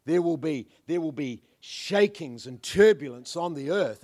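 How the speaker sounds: ordinary talk, nothing marked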